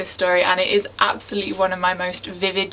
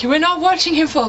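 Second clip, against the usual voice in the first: higher, louder, alarmed mum; frantic mum; 8 semitones higher, 4 dB louder than usual. Retold or imitated. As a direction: mum pulling the kids away from the edge, alarmed. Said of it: "she was like".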